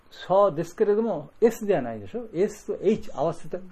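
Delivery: ordinary talk, nothing marked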